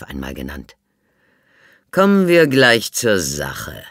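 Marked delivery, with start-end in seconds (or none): 1.9-3.8 slow, loud and measured inspector; the inspector opens the interrogation, slow and loud; Chief Inspector. A narrator's reading. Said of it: "sagte er".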